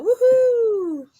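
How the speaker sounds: high, loud cheer; excited whoop. Real, not acted. Cheering as the guest joins.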